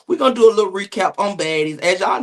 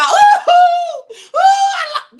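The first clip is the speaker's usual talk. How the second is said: hammed-up sobbing wail, much higher and louder; over-the-top funeral crying, mocked; 6 dB louder, much slower than usual. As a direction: wail like an overdone mourner. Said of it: "bitch, what you crying for".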